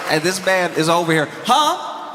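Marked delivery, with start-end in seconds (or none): 1.4-2.1 high-pitched startled "huh?"; mock panic, voice leaping up; panicked. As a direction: caught out and trying to hide it. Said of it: "You could hear from the panic in his voice".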